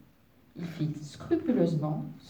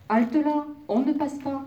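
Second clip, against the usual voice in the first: big wolf voice, raised high and loud; big growling wolf; 8 semitones higher, 4 dB louder than usual. A narrator's reading. Wolf challenges an intruder in the forest, big growling voice. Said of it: "gronda le grand loup de sa grande voix".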